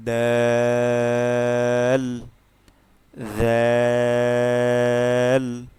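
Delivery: loud, slow chanted letter names; letter recital. Shown as a demonstration. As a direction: chant each Arabic letter in turn. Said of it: "আবার প্রথম থেকে ... revise করব".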